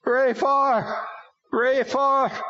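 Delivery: high, loud repeated demon cry. A narrator's reading. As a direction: call the name over and over like a demon. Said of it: "continued to cry out through Bobby".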